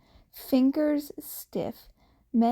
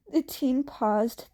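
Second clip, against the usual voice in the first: usual speaking voice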